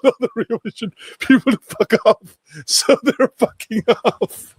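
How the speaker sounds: high-pitched, talking through laughter; laughing hard. Real, not acted. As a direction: barely get the words out for laughing. Cracking up as people leave.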